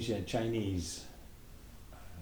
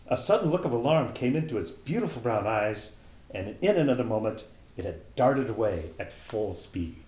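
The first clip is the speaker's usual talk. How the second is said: put-on lively, louder read-aloud voice for a children's tale; animated storyteller reading Alice in Wonderland; story narration; 4 semitones higher, 6 dB louder than usual. A narrator's reading.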